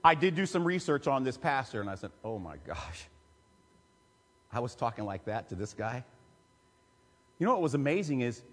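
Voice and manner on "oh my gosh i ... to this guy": low, much softer aside to himself; hushed horror at his own words; dismayed inner thought. Retold or imitated. Realizing he had scolded a respected pastor, low and hushed.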